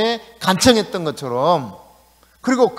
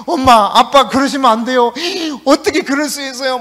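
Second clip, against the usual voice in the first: put-on pleading child; 5 semitones higher, 4 dB louder than usual. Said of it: "막 하면서".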